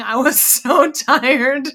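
slow, laughing through tired words. Real, not acted. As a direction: giggle as you admit it. Laughing about being tired.

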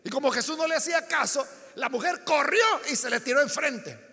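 ordinary delivery

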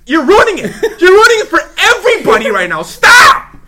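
high, loud laughing yell; exasperated shout. Retold or imitated. Scolding the shouting kids at the arcade, laughing shout.

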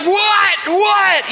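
high shouted what what; man being pounded, startled and high. Retold or imitated. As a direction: yelp it in alarm. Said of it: "he's like".

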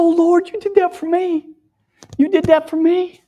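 loud, high outcry of wonder; awed cry out. Retold or imitated. Preacher reliving his outcry to the Lord, loud and awed. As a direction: cry it out, overwhelmed with gratitude.

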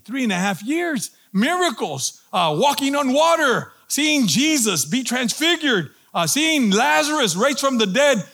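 high-pitched, disbelieving repeat of the quote; incredulous outrage. Acted. Preacher mocking Peter's denial after all those miracles, incredulous. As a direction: list each wonder in mounting disbelief.

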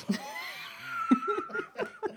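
high squealing laugh. Real, not acted. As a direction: let out a shrieking laugh. Laughs after shout.

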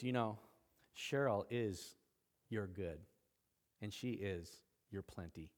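tenderly impart it, pausing between phrases; gentle voice of God. Retold or imitated. God tells husband about Cheryl, gentle and hushed. Voicing God speaking to him.

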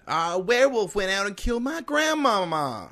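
put-on high boyish ad voice; child voice. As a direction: play the sad kid in the ad. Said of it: "sent us a recording".

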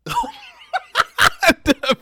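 squealing high laugh; loud shrieking laughter; high-pitched cackle. Real, not acted. Wrapper hit friend's face.

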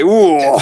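loud, high mocking "ooh"; mimicked ooh. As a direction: echo the co-host's ooh, hammed up. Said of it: "all I heard there was".

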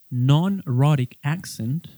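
non-rhotic accent, slow, British style; English term. Shown as a demonstration. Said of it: "un acento no rótico".

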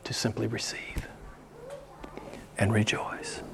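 whispered softly, almost secret; hushed whisper. Acted. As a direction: drop to a whisper to reveal it. Hushed answer after question.

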